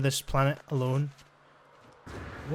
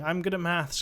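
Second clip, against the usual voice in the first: ordinary talk, nothing marked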